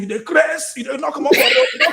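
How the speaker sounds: high, loud mock road-rage yell; hammed-up shout through laughter; shouting driver. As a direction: imitate an irate driver yelling at pedestrians. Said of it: "the driver is still saying".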